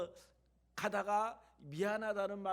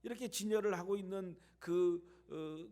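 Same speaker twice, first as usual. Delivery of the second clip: ordinary talk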